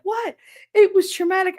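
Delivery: high, indignant put-on whine; mock offended coworker. Mocking the coworker protesting that it was traumatic, high indignant whine. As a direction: squeak out her indignation.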